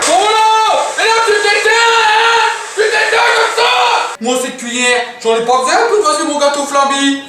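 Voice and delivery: high, shouted cartoon voice; Jojo character voice. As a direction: yell like an outraged kid.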